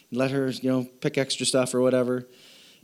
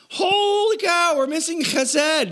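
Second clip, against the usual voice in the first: discovery; 13 semitones higher, slower than usual. Real, not acted. Sudden discovery of the missing word.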